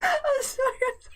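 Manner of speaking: high, still laughing; laughing apology. Real, not acted. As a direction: apologize through the giggles. After a laughing fit.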